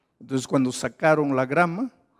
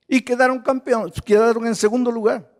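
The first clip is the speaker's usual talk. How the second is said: excited; 8 semitones higher, 5 dB louder than usual. Acted. Triumphant climax of the story.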